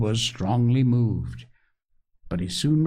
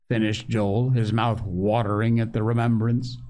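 plain reading voice

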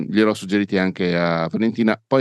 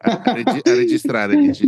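much higher, amazed question; incredulous; 3 dB louder than usual. Real, not acted. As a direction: squeak out the disbelief. Incredulous at the suggestion.

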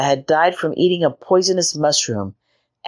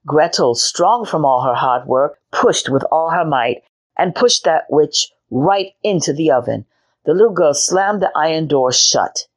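ordinary delivery, nothing marked

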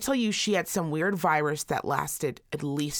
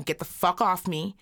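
ordinary delivery

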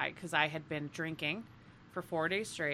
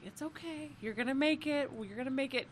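soft, higher, soothing reassurance; 6 semitones higher, 5 dB quieter than usual. Retold or imitated. Joe rubs her sick friend's back, softly soothing. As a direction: gently comfort a sick friend, a touch higher. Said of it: "rubs my back / She's like".

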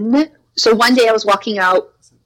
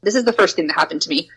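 ordinary talk, nothing marked

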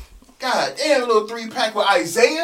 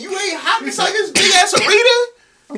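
laughing shout; 12 semitones higher, 5 dB louder, slower than usual. Real, not acted. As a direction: shout it through laughter, higher. Laughing rant at rapper.

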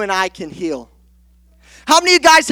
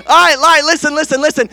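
ordinary delivery

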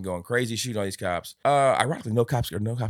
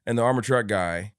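ordinary delivery

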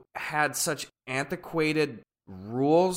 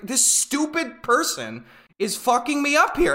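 higher-pitched retold exasperation; frustrated complaint; 7 semitones higher than usual. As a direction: complain it, fed up with the professor.

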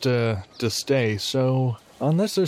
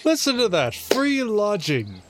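delighted; 7 semitones higher than usual. Real, not acted. Player delighted by free lodging.

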